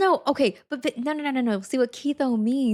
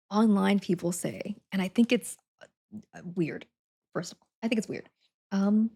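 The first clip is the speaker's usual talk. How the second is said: normal talking voice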